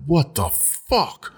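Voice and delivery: high, stunned reenactment; replayed shock, rising in pitch to the last word; retold shock. Acts out shock at hair loss.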